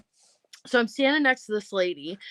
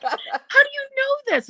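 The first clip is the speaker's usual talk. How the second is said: amazed. Retold or imitated. Amazed at the lady.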